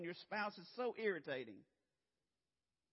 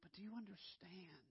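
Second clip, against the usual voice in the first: sudden whispered question to the congregation; 13 dB quieter than usual. Real, not acted. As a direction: drop to a whisper to draw them in. Preacher drops to intimate whisper.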